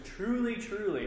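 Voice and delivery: first truly pitched high, second falling; emphatic quote. Retold or imitated. Teacher quoting Jesus with emphasis. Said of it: "where he says".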